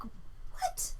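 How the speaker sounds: breathy high whispered what. Retold or imitated. Dreamer stunned by the intruder, whispered disbelief. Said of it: "I'm like".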